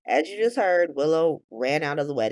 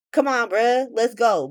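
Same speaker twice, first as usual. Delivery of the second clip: louder, higher impatient push; urging him on; 6 semitones higher, 4 dB louder than usual. Retold or imitated. Drew hurrying Martin to the fence, urging. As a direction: hustle a friend along, loudly.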